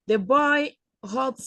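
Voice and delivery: ordinary delivery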